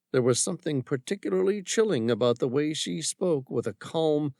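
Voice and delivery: ordinary delivery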